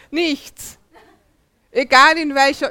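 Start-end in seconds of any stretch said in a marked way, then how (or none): 0.1-0.8 Bavarian accent, higher, "Nichts" with a drawn-out Bavarian vowel; a playful Bavarian "Nichts"; Bavarian dialect. Shown as a demonstration. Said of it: "Oder auf Bayerisch?".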